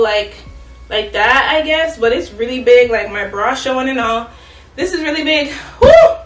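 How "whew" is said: loud high exhale of effort; winded whew. Real, not acted. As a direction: blow out, breathless after try-on. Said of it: "I'm out of breath".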